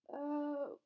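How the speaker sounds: high, hesitant stalling; nervous. A narrator's reading. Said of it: "looking suddenly nervous".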